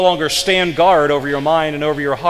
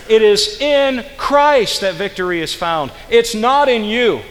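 fervent preaching; 6 semitones higher, 4 dB louder than usual. Acted. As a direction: preach it to the back row. Preaches at full force.

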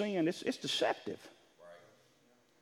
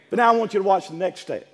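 ordinary talk, nothing marked

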